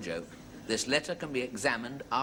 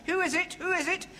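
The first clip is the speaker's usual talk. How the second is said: very high squawky call; shouted parrot phrase. Acted. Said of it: "you must remember to shout out".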